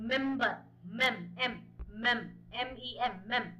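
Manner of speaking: "member, mem, m, mem" broken into sounds, slow; sounding out mem. Shown as a demonstration. Teacher breaks down member's first sound, slow syllable by syllable. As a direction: slowly split "member" into "mem" and "m". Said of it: "a consonant sound is coming".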